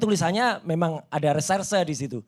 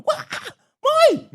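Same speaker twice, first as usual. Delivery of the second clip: high-pitched imitated scream; tiruan teriakan istri; 4 dB louder than usual. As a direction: mimic a wife's sudden loud cry. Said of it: "istri saya teriak". (meniru jeritan istri, melengking)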